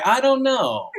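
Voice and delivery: KNOW stretched and pitched high, R on the end; high imitated accent on a long KNOW; dragged-out know. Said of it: "you drag out".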